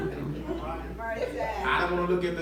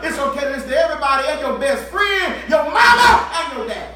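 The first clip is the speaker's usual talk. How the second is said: louder and faster, rising into a full shout; building preacher shout; 5 semitones higher, 9 dB louder, faster than usual. Real, not acted. Preacher building to a shout before his congregation. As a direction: drive the list home to the congregation.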